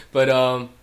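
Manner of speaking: ordinary delivery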